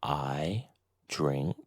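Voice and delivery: usual speaking voice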